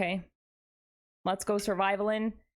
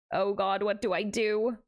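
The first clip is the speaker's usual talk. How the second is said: ordinary talk, nothing marked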